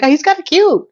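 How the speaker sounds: high, lilting swoon; smitten reaction. Retold or imitated. Recalling her smitten first reaction.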